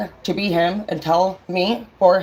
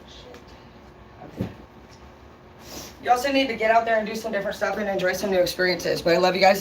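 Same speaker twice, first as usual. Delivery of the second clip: ordinary talk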